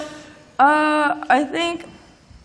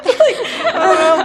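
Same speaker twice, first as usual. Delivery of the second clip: laughing; 8 semitones higher, 11 dB louder, slower than usual. Real, not acted. Laughing aside.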